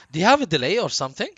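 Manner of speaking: ordinary delivery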